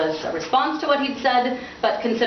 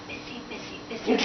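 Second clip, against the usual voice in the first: whispered, distracted busy; airy murmured dismissal; 3 semitones higher, 10 dB quieter than usual. Father dismissing son, whispered. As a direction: shoo him away absently.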